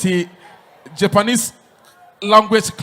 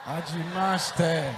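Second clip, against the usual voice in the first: put-on slow, soft learner's Japanese accent; hesitant beginner Japanese accent; 3 semitones lower, 11 dB quieter than usual. Learner repeats teacher's Japanese phrase.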